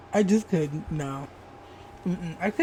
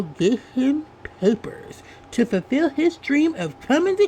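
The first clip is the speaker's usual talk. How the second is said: dramatic tweet read-out; 4 semitones higher than usual. Acted. Vlogger reads a juicy tweet to viewers, dramatic read-out.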